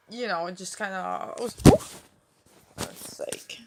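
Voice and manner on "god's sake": whispered curse under the breath; muttered under breath. Real, not acted. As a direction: hiss it out annoyed, barely voiced. Just dropped her phone.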